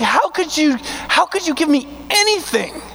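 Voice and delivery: high, amazed voice; high-pitched astonished gratitude; David's awe. Retold or imitated. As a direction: sound stunned that God would give you anything. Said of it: "David was like".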